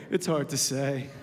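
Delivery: put-on low, deflated little boy; defeated kid mumble. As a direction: sigh it out like a beaten kid. Son's defeated answer.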